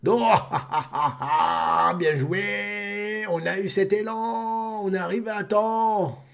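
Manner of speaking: high, slow put-on voice, laughing; dubbed giant character. Streamer voicing a relieved giant, high and drawn out. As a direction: draw it out in a cheerful put-on voice.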